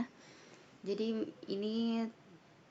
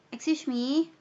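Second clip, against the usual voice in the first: a loud, high "Excuse me"; calling out; 5 semitones higher, 9 dB louder, slower than usual. Shown as a demonstration. Teacher demonstrates grabbing attention, loudly. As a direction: call it out loud and high to turn heads. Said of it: "tiba-tiba kita ngomong".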